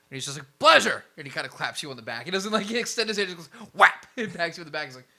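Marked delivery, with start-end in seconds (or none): 0.6-1.0 big, loud, high-pitched boom; boisterous acted exclamation; hearty greeting. 3.7-4.1 loud, high "whap" smack; sudden vocal smack effect; imitated slap. Imitates a clap on the back.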